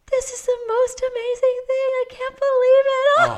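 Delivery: very high, loud imitation of a mother in labour; pushing, laughing ecstasy. Imitating mother pushing out her baby, ecstatic high squeal. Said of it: "Brittany was pushing and saying these words".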